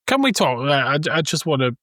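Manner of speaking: ordinary talk, nothing marked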